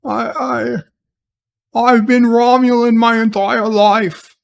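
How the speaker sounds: high, hammed-up put-on accent; mock waking confession in an affected accent; badly faked accent, starting high and groaning awake; Picard. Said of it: "that's why I have this accent".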